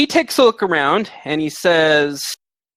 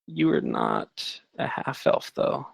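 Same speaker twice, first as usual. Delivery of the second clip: much lower, softer, slow correction; deep aside; 13 semitones lower, 8 dB quieter, slower than usual. Acted. GM correcting a player about her elf race, deep and slow. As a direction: drop the voice way down, drawn out.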